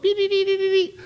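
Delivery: high beeps imitating an alarm; high alarm beeps from spiritual antennas; antenna beeping. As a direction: beep like a little detector, very high. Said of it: "spiritual antennas go up".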